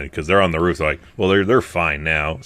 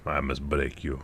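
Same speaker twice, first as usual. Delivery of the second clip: put-on much softer, low Russian accent; murmured Russian-accented threat; Dolph Lundgren; 5 semitones lower, 11 dB quieter than usual. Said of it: "I don't know how to do a Dolph".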